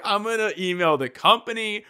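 a self-satisfied customer demanding his due; petty complainer. Retold or imitated. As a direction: play the smug coupon hunter. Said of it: "And then you're like".

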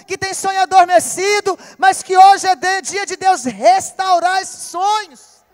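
fast, loud proclamation to the church; full-force preaching. Acted. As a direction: drive it home over the congregation. (pregação inflamada e rápida)

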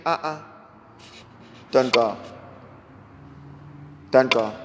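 low, soft and tender, coaxing him to stay; quietly, like calming a grieving father; Jesus gently reassures the grieving Jairus, low and soft; gentle reassurance. Acted.

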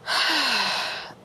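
long, falling exasperated sigh; heavy sigh. Retold or imitated. After recounting her exhausting class, heavy sigh. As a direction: let out a big defeated sigh.

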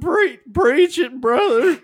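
high, loud, drawn-out country cheer; hammed-up Southern "preach it"; mock preacher's amen. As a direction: hoot it like a country churchgoer. Mock country congregation response.

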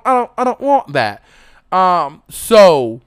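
usual speaking voice

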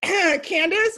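high, loud retold answer after clearing the throat. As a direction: say the name up and out for all to hear. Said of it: "Say it louder for the people".

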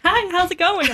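high, chirpy service greeting; customer service voice. Retold or imitated. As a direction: switch on the friendly counter voice. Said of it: "instant voice change".

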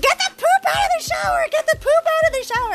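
high, loud panicked yell; frantic yelling. Retold or imitated. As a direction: shout it in a panic, fast. Husband yelling from shower.

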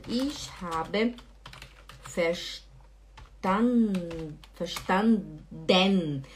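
very slow "verstanden", broken into separate syllables; writing-pace dictation. Shown as a demonstration. Teacher writing it out for viewers, slow syllable-by-syllable. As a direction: spell out "verstanden" by sound, ending on a full DEN. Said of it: "Eu vou escrever aqui pra vocês".

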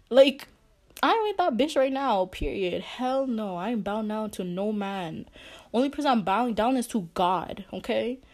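loud, high-pitched emphatic refusal; defiant outburst. Real, not acted. Woman telling viewers she bows to no man, loud and defiant.

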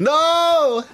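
long, high-pitched "no" wail; mock protest yell. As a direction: cry out in playful horror. Protesting a teasing ASMR whisper.

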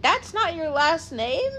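high, disbelieving squeak of a question; mock astonished what; surprised question. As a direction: act out people's shock at her name. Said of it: "people are like".

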